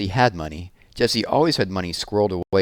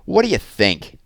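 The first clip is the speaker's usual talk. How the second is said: louder, higher angry shout; boy yelling at his sister, nose throbbing; 6 semitones higher, 5 dB louder than usual. Acted. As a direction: snap it back at her in pain. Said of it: "he shouted".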